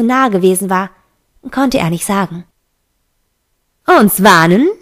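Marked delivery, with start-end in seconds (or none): 3.9-4.7 scornful echo with a swooping question rise; mocking disbelief; jeering. A narrator's reading. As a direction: throw the question back in mockery. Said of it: "höhnte Molly".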